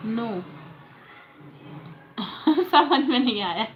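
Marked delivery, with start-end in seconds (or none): 2.2-2.7 short burst of laughter. Real, not acted. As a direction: laugh in puzzlement. समझ न आने पर हँसना. (उलझन भरी हँसी)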